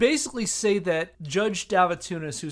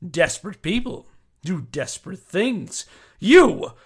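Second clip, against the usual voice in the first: louder, declaiming each charge like a prosecutor; dramatic letter reading; 4 semitones higher, 8 dB louder than usual. Acted. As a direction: read it out as a righteous indictment. Reads the accuser's letter aloud.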